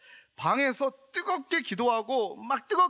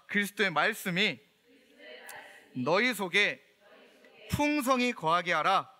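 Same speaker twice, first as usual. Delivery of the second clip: measured, deliberate verse reading, phrase by phrase; slow scripture recital for repeating. Shown as a demonstration. Preacher leading the congregation in a Bible verse, slow and measured. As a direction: lead the congregation through the verse slowly. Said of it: "함께 따라합시다".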